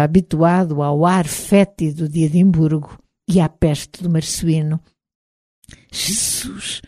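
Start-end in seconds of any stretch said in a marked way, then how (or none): 5.9-6.8 hushed murmur, much softer. A narrator's reading. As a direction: murmur it under your breath. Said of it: "murmurou Fergus ao meu lado".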